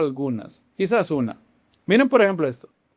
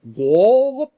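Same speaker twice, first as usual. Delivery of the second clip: astonished, higher Scottish-style exclamation; mock Scottish accent; 4 semitones higher, 4 dB louder than usual. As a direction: exaggerate a surprised Scottish "what", rising at the end. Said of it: "en acento escocés debe de ser algo como".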